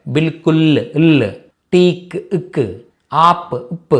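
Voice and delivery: బిల్కుల్, టీక్, ఆప్ slowly, each closed on its last consonant; cut-off endings. Shown as a demonstration. Teacher drills final consonants, బిల్కుల్, టీక్, ఆప్ cut at the end. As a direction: isolate the endings ఇల్, ఇక్, ఉప్ with no vowel after. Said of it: "చివర్లో cut చేసి పలకాలి".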